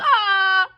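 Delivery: high, loud pained howl; sharp high ah; gorilla yelp. Acted. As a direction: gorilla cries out from a bite. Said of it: "the gorilla yelled".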